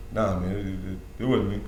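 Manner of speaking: quiet, low, mumbled denial; teen mumbling nah it wasn't me. Retold or imitated. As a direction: mumble it like a caught kid. Young self denying, mumbled.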